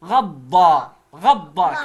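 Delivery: غضا joined together, loud; blended syllables. Shown as a demonstration. Teacher blending the parts of غضا for the class. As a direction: run غضا together in one go. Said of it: "كلها على بعضها".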